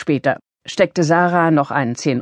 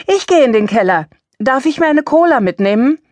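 put-on quick, higher, louder child's call; eager call; 9 semitones higher, 4 dB louder than usual. A narrator's reading. Sarah calls out to her grandmother, eager and higher. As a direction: jump in eagerly to smooth things over. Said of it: "und Sarah rief eilig".

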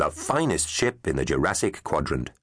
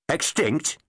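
higher, puzzled one-word query; puzzled question; 8 semitones higher than usual. A narrator's reading. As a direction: ask it like you misheard. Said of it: "asked a puzzled journalist".

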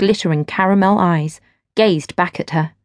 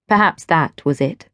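usual speaking voice

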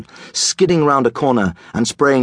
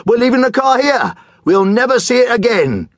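high, loud, carefree glee; giddy elation; 11 semitones higher, 4 dB louder than usual. Acted. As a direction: shout it in reckless joy. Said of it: "He laughed out loud".

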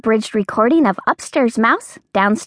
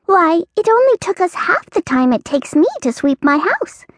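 mouse voice; 7 semitones higher than usual. Acted. Mouse character voice.

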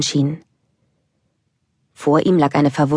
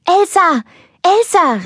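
high, loud wake-up call to her sister; 14 semitones higher, 4 dB louder, slower than usual. Acted. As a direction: call your sister awake across the room. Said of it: "rief sie". (laut und hoch rufend)

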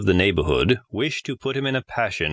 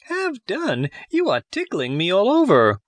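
high, giggling little voice; tiny wooden voice laughing; 10 semitones higher than usual. A narrator's reading. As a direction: squeal it out as if tickled.

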